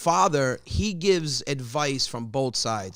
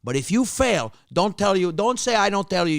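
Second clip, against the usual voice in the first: higher, louder mock dad; hammed-up father; 7 semitones higher, 4 dB louder than usual. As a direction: pitch up the father's rambling. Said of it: "He goes".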